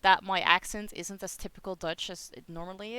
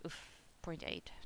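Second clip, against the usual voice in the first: slow unvoiced whisper, much softer; whispered aside; 3 semitones lower, 17 dB quieter than usual. Real, not acted. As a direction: breathe it out to yourself. Reading own fever temperature.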